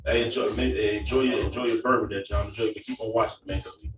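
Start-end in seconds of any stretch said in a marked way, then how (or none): none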